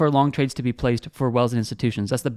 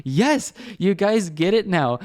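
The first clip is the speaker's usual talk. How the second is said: slow, higher, put-on approving voice; drawn-out pleased praise, playfully voiced; approving himself; 4 semitones higher, slower than usual. Mock-answering his traders' emails, slow and pleased. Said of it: "I'm like".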